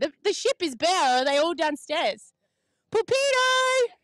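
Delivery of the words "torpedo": high, slow, drawn-out call of torpedo; Torpedo! called out long and high. Real, not acted. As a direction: call him up from below decks. Calling down to the crew below.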